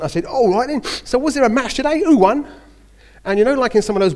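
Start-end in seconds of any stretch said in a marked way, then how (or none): 0.3-2.5 loud, high cheery Cockney question; breezy Cockney lad asking who won; Cockney accent. Retold or imitated. Young Cockney lad in a Welsh club asking about the match, loud cheery Cockney. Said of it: "in my Cockney accent".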